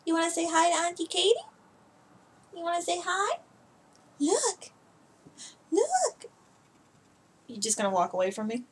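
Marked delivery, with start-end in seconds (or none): 0.0-6.2 high cooing pet voice. Real, not acted. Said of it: "I talk to my cats like they're children".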